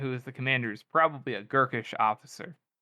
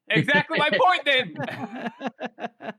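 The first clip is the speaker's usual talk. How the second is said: high-pitched, laughing outburst; excited agreement; 13 semitones higher, 4 dB louder, much slower than usual. Real, not acted. Co-host seizing on the joke, excited high agreement. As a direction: burst out in triumphant agreement, voice way up.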